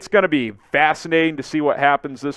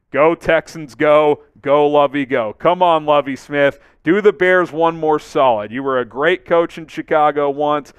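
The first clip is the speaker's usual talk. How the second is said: loud, pleading fan cheer; rallying cheer; 2 semitones higher, 6 dB louder than usual. Real, not acted. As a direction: urge the team on, louder and punchier. Cheering on a team and coach.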